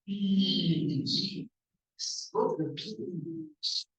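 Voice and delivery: "beach" with a very long, careful ee; long ee demonstration. Shown as a demonstration. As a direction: stretch "beach" so it cannot be misheard. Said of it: "not the other place".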